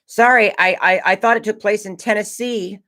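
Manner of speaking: put-on high-pitched kid saying sorry; apologetic kid. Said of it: "as, like, a twelve or thirteen-year-old kid".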